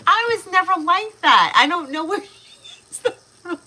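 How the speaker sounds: high, loud, laughing through the words; real amused giggly outburst. Real, not acted. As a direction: say it cracking up. Laughing over daughter's temper.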